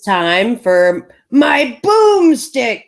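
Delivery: slow, hammy action hero; movie hero line. Pretend. Quoting Army of Darkness line.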